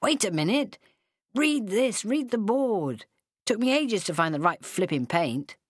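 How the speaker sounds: high, insistent calling; shouted. A narrator's reading. Ed calls to her on the sand, a mild high shout. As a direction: call it out urgently, without full volume. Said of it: "he shouted".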